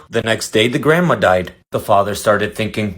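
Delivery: ordinary talk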